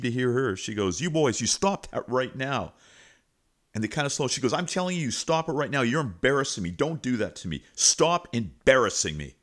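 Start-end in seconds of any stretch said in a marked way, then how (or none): 1.0-2.7 louder, higher mock yell; retold yell. Principal breaks up a hallway fight, imitated yelling. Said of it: "she yells at the top of her voice". 4.5-9.4 raised, higher-pitched scolding; retold yell. Principal orders fighting boys to stop, imitated yelling. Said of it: "and she starts yelling".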